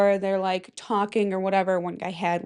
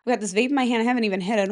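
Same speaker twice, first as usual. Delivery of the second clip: normal talking voice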